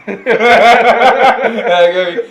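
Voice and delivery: high burst of laughter through the words; laughing at the memory; laughing hard. Real, not acted. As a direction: crack up mid-sentence. Laughing while telling story.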